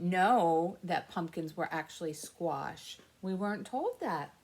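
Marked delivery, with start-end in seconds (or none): none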